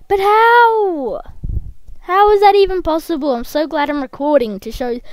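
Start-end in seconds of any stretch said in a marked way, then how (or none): 0.1-3.3 high, loud incredulous wail; loud disbelief. Real, not acted. Player puzzling over an impossible glitch, loud disbelief. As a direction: cry out in astonishment.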